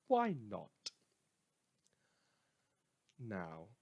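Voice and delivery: usual speaking voice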